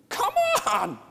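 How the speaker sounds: high loud exasperated yelp; hammed-up cry of exasperation; mock scream. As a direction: shriek it in mock frustration. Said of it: "I want to scream".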